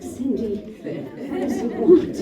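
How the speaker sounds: loud, high-pitched mock panic; comic jittery outburst, rising high; nervous wreck. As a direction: plead anxiously, hammed up for laughs. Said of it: "I was a nervous wreck".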